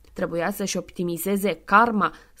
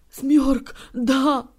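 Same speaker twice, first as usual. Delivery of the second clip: sniffling yes; 4 semitones higher, 5 dB louder than usual. Acted. Santa sniffling agreement.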